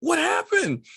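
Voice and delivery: high startled question; alarmed question. Retold or imitated. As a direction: demand to know in alarm. Waking up alarmed in car.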